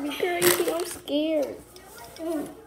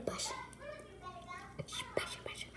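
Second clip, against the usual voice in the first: whispering; 5 semitones higher, 18 dB quieter, faster than usual. Real, not acted. Quiet repeat after tasting sauce.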